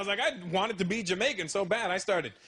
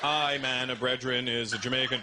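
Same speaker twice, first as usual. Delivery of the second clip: quiet Jamaican; 7 semitones lower than usual. Retold or imitated. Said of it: "Like this".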